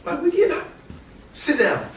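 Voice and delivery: can't believe he's benched; indignant. Retold or imitated. Cash pulled from the game, high indignant.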